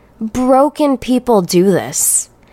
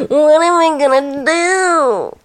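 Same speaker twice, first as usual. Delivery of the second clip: hammed-up wail, pitched higher; mock despair; 6 semitones higher than usual. Mocking the commenters with fake higher despair. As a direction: overplay helplessness as a joke.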